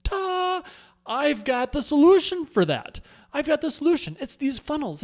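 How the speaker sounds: high, loud burst of excitement; retelling his own excited pitch; excited eureka. Retold or imitated. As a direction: pitch it up like you just found the answer. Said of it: "that's when I went".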